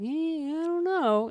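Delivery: high, slow, doubtful mimicry; mocking. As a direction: drag it out like an unsure friend. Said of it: "you're like".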